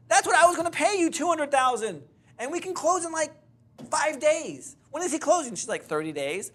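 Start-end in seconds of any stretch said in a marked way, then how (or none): 0.1-5.5 high, loud frantic pleading; exasperated outburst. Retold or imitated. Realtor berating the seller over the lost deal, high frantic shout. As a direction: shout it at her, pitched way up.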